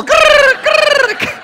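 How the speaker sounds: high, loud chirping imitation; imitating crickets. Mocking cricket as silence, high loud cricket chirp. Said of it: "That is the sound of fuck all".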